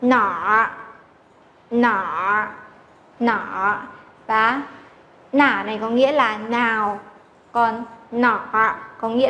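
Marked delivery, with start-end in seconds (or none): 0.0-3.8 "nỏ" repeated with a curled tongue, as nǎr; teacher repeating "nỏ"; retroflex drill. Shown as a demonstration. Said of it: "chúng ta sẽ phải đọc là".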